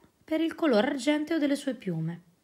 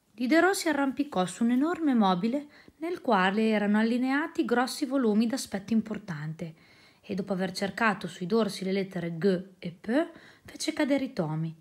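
plain reading voice

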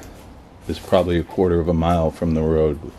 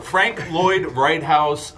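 each word slowly placed; slow and careful; 10 semitones higher, much slower than usual. Shown as a demonstration. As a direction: say the name slowly and deliberately. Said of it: "I had a s-, quick stroke".

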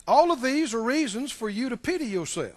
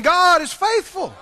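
higher, louder, slower God is faithful; preacher pushes the crowd to say it louder; louder call; 7 semitones higher, 8 dB louder, slower than usual. Shown as a demonstration. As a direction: make them say it stronger. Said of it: "Say it again".

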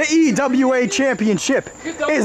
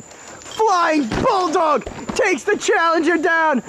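wrestling commentator; 4 semitones higher than usual. Acted. Continues play-by-play.

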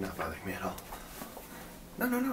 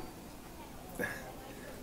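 soft awkward giggle; nervous laugh; 11 dB quieter than usual. Acted. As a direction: laugh off the embarrassment. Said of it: "being stupid right now".

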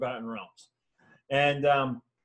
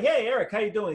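innocent greeting; 7 semitones higher, 3 dB louder than usual. Retold or imitated. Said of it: "and I'm like".

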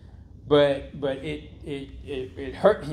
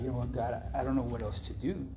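quiet, slightly lowered retold plea; retold helpless prayer; 3 semitones lower, 9 dB quieter, faster than usual. Man retelling his rehab-floor prayer to God, quietly helpless. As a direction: softly recount giving up before God.

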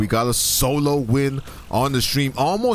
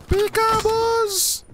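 very high falsetto sing-song; drawn-out, extremely high playful call; playful squeal; much slower than usual. Pretend. Repeats the call far higher.

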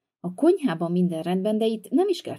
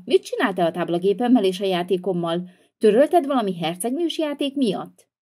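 ordinary talk

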